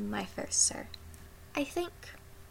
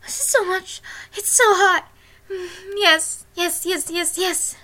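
ecstatic moaning; 7 semitones higher, 8 dB louder than usual. Acted. Climax afterglow.

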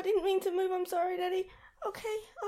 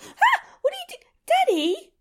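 sudden loud high gasp; startled cry; 13 dB louder than usual. Acted. Thrown off her partner's lap, startled shout. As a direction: jolt up in shock.

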